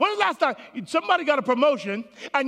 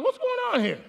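higher, puzzled exclamation; puzzled surprise; 12 semitones higher, faster than usual. Retold or imitated. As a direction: sound caught off guard by the warning light. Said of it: "I'm like".

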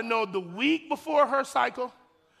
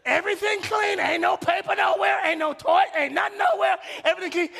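much higher and louder; full-force preacher excitement; excited shouting; 8 semitones higher, 4 dB louder than usual. Real, not acted. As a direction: shout it in joy. Preacher excitedly describes clean house.

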